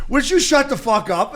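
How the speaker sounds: high, loud, fed-up retold yell at a noisy roommate; exasperated yell. Yelling at drunk dad at 4:30.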